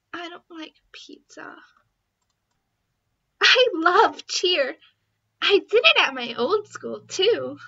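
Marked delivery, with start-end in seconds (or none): 0.1-1.6 hushed secret whisper. Acted. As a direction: confess it under your breath. Shameful confession.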